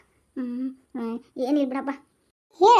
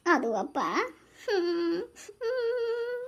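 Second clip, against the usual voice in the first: crying softly; 3 semitones higher, 3 dB quieter, much slower than usual. Acted. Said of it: "hang ಅಳ್ಬಾರ್ದವ್ವ".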